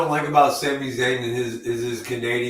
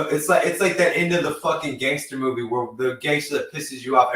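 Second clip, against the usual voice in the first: normal talking voice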